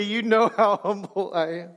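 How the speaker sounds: breaking into laughter mid-line; can't keep a straight face; laughing. Real, not acted. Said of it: "I can't even say it without laughing".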